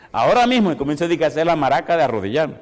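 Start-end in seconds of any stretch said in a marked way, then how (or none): 0.1-0.7 louder, higher AHORA mismo, no room to refuse; firm, raised insistence. Retold or imitated. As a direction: plant your feet: Ahora mismo. Repeats insistence more forcefully.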